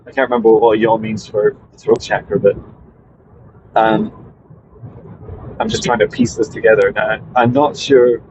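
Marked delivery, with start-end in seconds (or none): none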